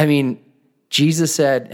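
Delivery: ordinary talk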